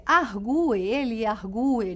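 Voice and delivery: "argue" drawn out as ar-GU-e, stress on the U. Shown as a demonstration. Teacher giving another example, "argue" with slow stressed U. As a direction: slowly lean on the U in "argue". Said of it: "destacar esse U tônico".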